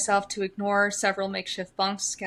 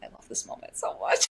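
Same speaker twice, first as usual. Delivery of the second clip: breathy whisper. Real, not acted. Emotional aside.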